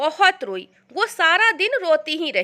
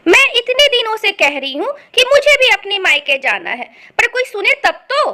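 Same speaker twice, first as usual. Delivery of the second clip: loud, aggrieved wife; fed-up, raised-voice grumble; exasperated complaint; 4 semitones higher, 5 dB louder than usual. Acted. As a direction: complain loudly to a husband who won't listen. Wife complains to her husband.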